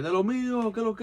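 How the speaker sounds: high-pitched street Spanish greeting; talking to the boys. Shown as a demonstration. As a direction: hail your boys in casual Spanish. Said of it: "when my boys".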